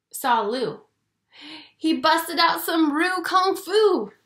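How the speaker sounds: usual speaking voice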